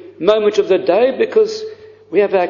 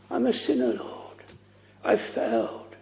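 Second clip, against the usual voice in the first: much softer, whispered prayer of a sinner; whispered confession; 2 semitones higher, 12 dB quieter than usual. Acted. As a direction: breathe it out like a broken confession. Voicing a penitent sinner's prayer.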